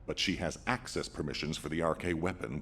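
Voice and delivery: ordinary delivery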